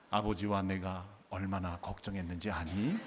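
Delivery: low, soft, overly composed parent, rising at the end; 점잖은 낭독. Acted. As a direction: read it with mock genteel restraint. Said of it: "이렇게 점잖게 낭독을 했겠습니까". (점잖게 낮고 부드럽게, 끝만 올려서)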